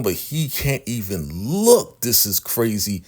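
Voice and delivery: ordinary talk, nothing marked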